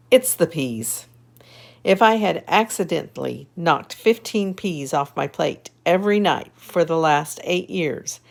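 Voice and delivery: lively storybook reading; reading to children. A narrator's reading. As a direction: read it brightly to little kids. Reads picture book aloud.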